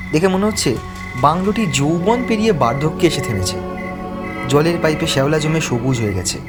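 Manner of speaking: plain reading voice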